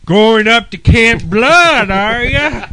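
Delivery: high, loud, slow creepy yokel; slow ominous raspy drawl. Pretend. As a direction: drawl it like the creepy local warning strangers. Movie riffing, creepy local voice.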